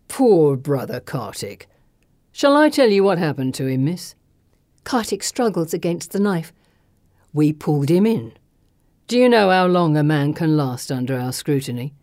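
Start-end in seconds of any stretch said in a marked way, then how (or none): 0.1-4.1 taunting thug. A narrator's reading. Thug holding a knife to his captive, taunting. 7.3-11.9 low-pitched villain voice; menacing thug. A narrator's reading. Thug lecturing his captive on change, menacing.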